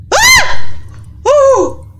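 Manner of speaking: very high, loud shriek, then a yelp; real scream of sudden fright; startled scream. Real, not acted. Player after asking who was that, startled scream. Jump scare in game.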